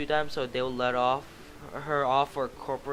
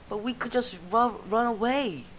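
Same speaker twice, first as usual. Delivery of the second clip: scared recruit; 9 semitones higher than usual. Acted. Recruit objects.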